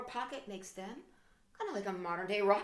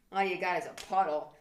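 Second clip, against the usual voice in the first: ordinary delivery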